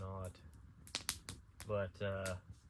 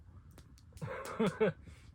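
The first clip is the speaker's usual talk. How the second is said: laughs; 10 semitones higher than usual. Real, not acted. Laughing at own story.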